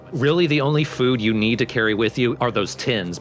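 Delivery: ordinary talk, nothing marked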